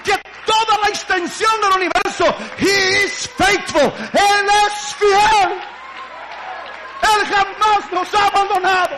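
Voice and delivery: high, loud, slow shouting proclamation; full-throated revival shout; preaching at full force. Acted. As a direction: proclaim God's faithfulness at the top of the voice.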